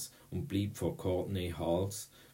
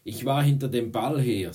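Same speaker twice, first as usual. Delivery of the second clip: loud, higher, annoyed insistence; through gritted teeth; 5 semitones higher, 10 dB louder than usual. A narrator's reading. Boy defends himself to the girls, through gritted teeth. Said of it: "mit zusammengebissenen Zähnen".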